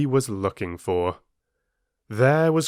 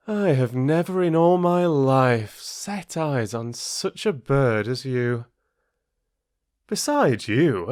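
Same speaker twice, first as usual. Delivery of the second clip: higher, gushing fox flattery; a sly fox gushing over the crow; flattering fox; 4 semitones higher than usual. Acted. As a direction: pour on the praise.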